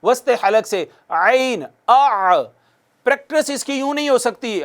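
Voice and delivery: usual speaking voice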